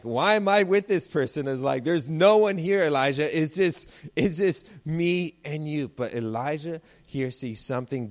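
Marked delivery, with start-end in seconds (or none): 0.0-1.5 high, loud alarmed outburst; mock panic. The servant panicking beside Elijah, high loud mock alarm. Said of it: "I'd be freaking out". 1.8-5.9 high, loud anxious pleading, slowing at the end; mock panic. The servant pleading with Elijah, high loud mock fear. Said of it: "I'd be freaking out".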